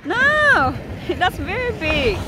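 high, loud startled no, then laughing; shocked delight at the size; surprised. Real, not acted. Sees the big fish.

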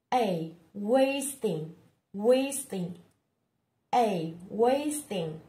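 "wasting" stretched out slowly; slow drill. Shown as a demonstration. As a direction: drag out "a wasting" syllable by syllable. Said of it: "drilling the word wasting".

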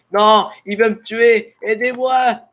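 high, slow cry for help; panicked plea. Real, not acted. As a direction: beg for help in fright. Zombie about to kill him.

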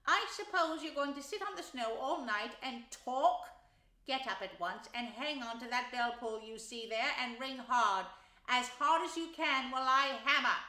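loud, bossy scolding; unkind, impatient Rat. A narrator's reading. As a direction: order a dawdling friend about, punching the commands. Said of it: "interrupted the rat rather unkindly".

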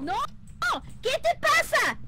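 high loud jolt of alarm; startled cry. Real, not acted. Startled by the ghost while playing, a high alarmed cry. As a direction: yelp it as if something jumped out. Sudden scare in ghost game.

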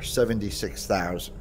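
usual speaking voice

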